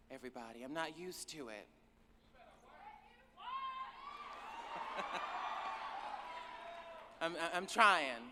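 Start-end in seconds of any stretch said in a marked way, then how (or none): 3.4-7.0 breathy laugh. Real, not acted. Laughing at her own confession.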